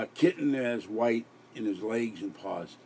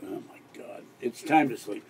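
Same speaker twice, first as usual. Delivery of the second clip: ordinary talk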